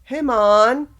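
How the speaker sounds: loud, high mock Jamaican greeting; playfully hammed-up Jamaican accent. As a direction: holler it in a put-on Jamaican accent. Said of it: "you woke up and you were like".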